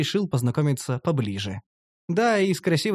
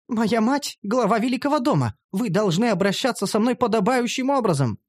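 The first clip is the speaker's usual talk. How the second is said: higher haughty noble elf; insistent elf girl; 6 semitones higher than usual. Acted. Elf girl insists her mother heads a great house, higher and haughty. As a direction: insist on your rank. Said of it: "настаивала эта идиотка".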